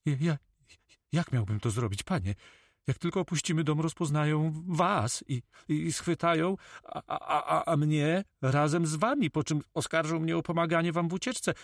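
high-pitched, nervous notary; scared captive pleading his case; frightened stammer. Acted. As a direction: stammer anxiously, tripping over repeated words.

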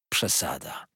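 ordinary delivery, nothing marked

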